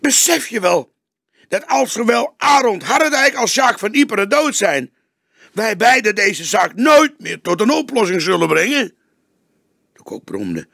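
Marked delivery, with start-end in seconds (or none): 0.0-9.0 much higher, louder desperate question; young detective pushing his boss, urgent. A narrator's reading. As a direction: make him see it.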